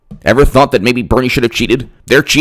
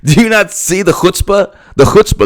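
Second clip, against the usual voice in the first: high, disbelieving "chutzpah"; stunned by the nerve of it; incredulous; 10 semitones higher than usual. Real, not acted. Telling viewers Clinton's gall is unbelievable, incredulous and high.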